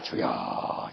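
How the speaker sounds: unvoiced, soft imitation of praying; hushed prayer call. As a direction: whisper a tentative call to the Lord. Imitates man's first prayer call. (기도를 흉내 내며 속삭이듯)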